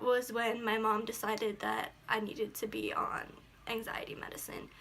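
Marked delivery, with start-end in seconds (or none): 3.7-4.7 dropped to a whisper. Real, not acted. Admitting she needed anxiety medicine, whispered. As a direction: say it under your breath. Confessing hard memory.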